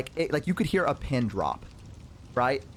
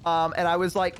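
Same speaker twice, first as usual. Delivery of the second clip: ordinary talk, nothing marked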